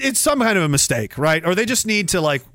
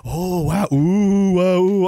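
mock dazzled gasping; slower than usual. Mocking people distracted by jingling keys, dazzled cooing. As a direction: coo like someone shown shiny keys.

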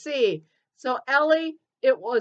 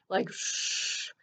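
breathy shh; 8 dB quieter than usual. Shown as a demonstration. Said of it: "comes upon us like a breath".

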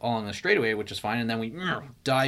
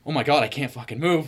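retold panic; 5 semitones higher, 5 dB louder than usual. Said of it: "that's when I started freaking out".

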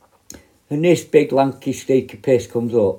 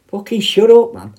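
retold snapping yell; 8 semitones higher, 5 dB louder than usual. Officer snaps back at prisoner.

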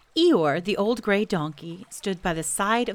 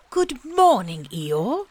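higher, louder friendly bear greeting; cheerful Pooh; 5 semitones higher, 6 dB louder, slower than usual. A narrator's reading. Pooh coming out of the bracken to greet Eeyore, cheerful and high. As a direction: call out a happy hello to a friend.

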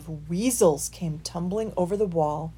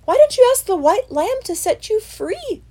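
mouse voice; 9 dB louder than usual. A narrator's reading. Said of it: "continued the mouse".

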